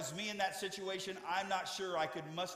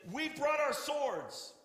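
much higher, slow, loud; proud eager shout; excited cry; 11 semitones higher, 5 dB louder, much slower than usual. Acted. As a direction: disciples showing off their swords, much higher. Disciples urging a fight.

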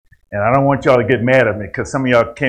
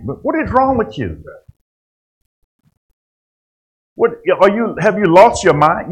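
high, incredulous scolding; a parent rebuking a grown child who acts like a baby; mock-outraged mother; 8 semitones higher than usual.

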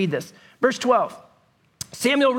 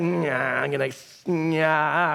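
reluctant brush-off; 9 semitones lower, 4 dB quieter, much slower than usual. Retold or imitated. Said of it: "you're like".

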